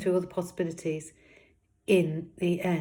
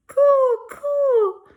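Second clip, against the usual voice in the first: put-on very high, loud babbling baby cry; baby shouting coo; 14 dB louder than usual. Baby rabbit shouting for attention, shrill squeal. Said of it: "shouting at the top of her voice".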